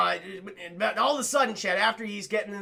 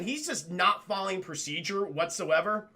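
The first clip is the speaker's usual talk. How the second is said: usual speaking voice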